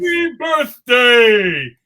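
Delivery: drawn-out, high, loud greeting; stretched birthday cheer; slow joyful shout. Acted. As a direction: bellow it slowly and happily. Snowman's first words, shouted.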